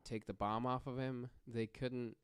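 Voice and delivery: usual speaking voice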